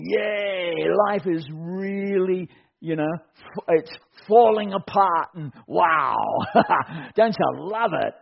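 sarcastically beam through the collapse; hammed-up delight that breaks into a laugh; mock bubbly cheer. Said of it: "walk around all bubbly and happy".

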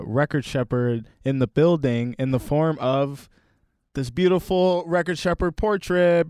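ordinary talk

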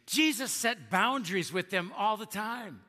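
normal talking voice

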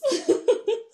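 high, loud laugh breaking out; burst of laughter. Real, not acted. Laughing at own rant.

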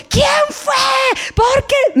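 very high, mock interrogating demand; scolding parent. Parent interrogating the kids, very high and demanding. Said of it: "¿ya para qué decimos".

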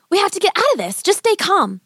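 put-on high-pitched, urgent child's voice; urgent girl. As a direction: urge your brother to keep his head. Sister urges calm while panicked.

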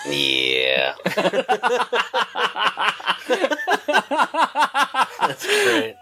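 high squealing laughter; can't stop laughing; laughing hard. Real, not acted. Cracking up at a joke.

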